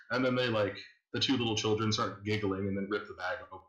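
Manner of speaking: ordinary talk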